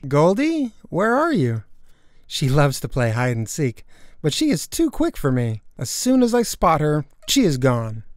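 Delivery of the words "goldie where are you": higher, questioning call; searching call. Acted. Girl looking for her hidden pet, searching call. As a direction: call out for someone hiding.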